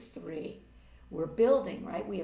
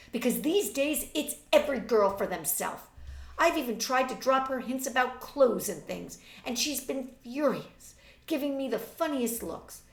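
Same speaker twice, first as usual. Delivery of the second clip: put-on catty young woman; 3 semitones higher, 7 dB louder than usual. A narrator's reading. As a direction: gossip with scorn. Reads Marjorie's gossip.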